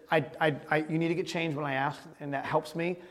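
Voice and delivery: low, soft, halting parental explanation; subdued and patient; gentle explaining. Retold or imitated. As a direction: explain quietly to a child. Retold apology to his son.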